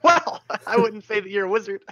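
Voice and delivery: loud, through laughter; laughing tease. Real, not acted. Player teasing the wizard, laughing. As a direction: rib a friend while cracking up.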